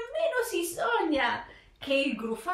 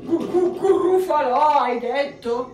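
stammering panic; 6 semitones higher, 7 dB louder than usual. Acted. Gruffalo panics and flees.

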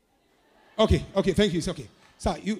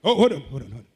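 usual speaking voice

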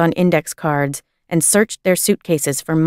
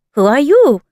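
a louder, higher startled demand; 6 semitones higher, 5 dB louder than usual. A narrator's reading. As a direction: jump and challenge the stranger.

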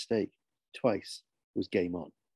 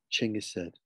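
ordinary delivery, nothing marked

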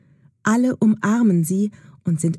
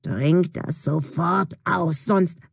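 put-on threatening old witch; slower than usual. A narrator's reading. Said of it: "sagt ihr mit drohender Stimme".

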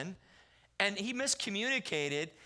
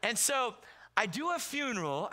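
usual speaking voice

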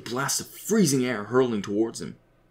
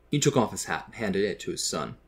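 plain reading voice

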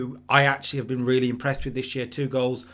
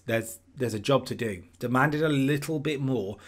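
usual speaking voice

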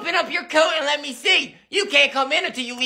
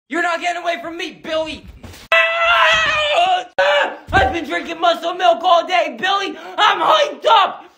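put-on hyped-up kid shout; 4 semitones higher than usual. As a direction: yell it pumped up for a fight. Brother chasing, hyped up.

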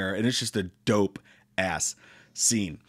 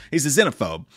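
ordinary delivery

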